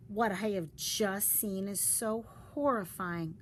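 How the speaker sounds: usual speaking voice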